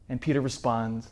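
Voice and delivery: ordinary delivery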